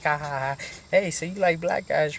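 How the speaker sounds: high-pitched imitation of a taunting joker; joking taunt. Naked inmate taunting him in the shower, jokey. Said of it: "trying to be funny and shit".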